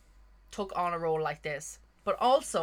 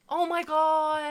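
shocked gasp; 9 semitones higher, 4 dB louder, much slower than usual. Real, not acted. Shocked reaction to lovers reveal.

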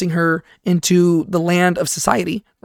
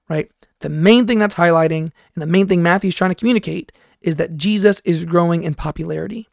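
normal talking voice

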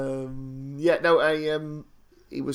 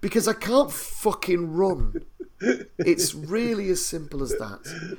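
higher, slow, drawn-out comic insistence; exasperated mock protest; 5 semitones higher, slower than usual. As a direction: spell it out word by word. Retells his emphatic protest to chef.

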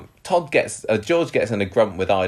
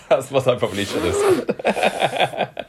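laughing; 13 semitones higher, slower than usual. Real, not acted. Laughing at own joke.

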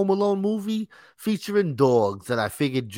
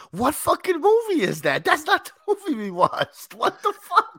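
incredulous outburst. Real, not acted. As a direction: howl in disbelief. Outraged disbelief at movie.

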